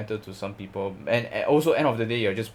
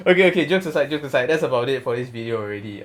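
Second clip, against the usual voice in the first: laughing; 4 semitones higher, 7 dB louder than usual. Real, not acted. Laughing after joke about rival.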